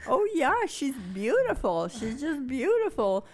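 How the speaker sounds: high, bright-eyed gush; proud delight. Retold or imitated. Mother telling the doctor about her daughter, proudly excited. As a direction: beam about your daughter.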